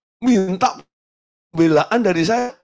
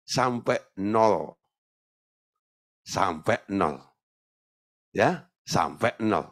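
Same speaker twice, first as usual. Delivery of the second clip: soft, slow exhortation; 6 semitones lower, 3 dB quieter, much slower than usual. Acted. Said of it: "repeated soft emphasis".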